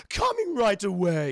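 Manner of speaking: loud, slow server's call; a hint of an eager waiter. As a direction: call it out, drawing the words out. Waiter calls out acknowledgment of order.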